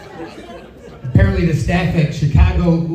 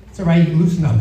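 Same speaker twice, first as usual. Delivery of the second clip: usual speaking voice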